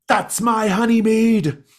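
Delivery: high, booming outraged bellow; furious shout. Acted. Old man accusing drinkers of taking his mead, furious shout. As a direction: roar it across the room. Tavern keeper storms in accusing.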